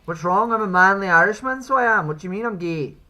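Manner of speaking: loud, indignant Irish protest; mock indignant. Streamer protests to teasing caller he's manly, mock-indignant Irish accent. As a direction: puff up as a proud Irishman.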